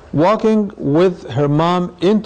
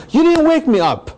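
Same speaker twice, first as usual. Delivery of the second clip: put-on high-pitched child's protest, falling at the end; much higher voice of a child let down; complaining child; 11 semitones higher than usual. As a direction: imitate a kid's indignant complaint. Child complains, not woken for prayer.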